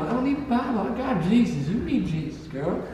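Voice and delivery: put-on southern accent. Bayou local telling off a Bible seller, southern accent. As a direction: preach back in a southern accent. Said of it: "I love that accent".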